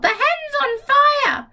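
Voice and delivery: high, slow, shrill-pitched cry of alarm; alarmed grandma yell. Acted. Grandma yelling from the roof that the hen is on fire. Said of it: "Grandma yelled".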